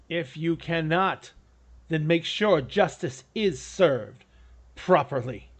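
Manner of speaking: slow, measured decree; grave instruction. Acted. Lord charges party with justice, grave and measured. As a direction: spell out each phrase slowly and deliberately.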